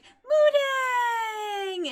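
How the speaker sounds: high, long drawn-out gleeful cry; delighted high-pitched call, held long; happy squeal. Retold or imitated. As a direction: imitate an overjoyed fangirl squeal, stretched out loud. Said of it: "her being like".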